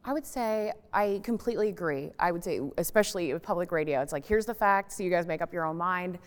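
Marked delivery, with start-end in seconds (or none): none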